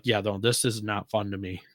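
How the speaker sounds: normal talking voice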